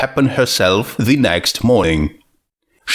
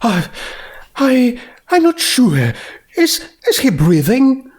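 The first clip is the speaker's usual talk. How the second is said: much higher, stuttering fright; Rarity shaken, stammering and much higher; nervous stammer; 13 semitones higher than usual. A narrator's reading. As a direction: trip over words in shock. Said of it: "her racing heart".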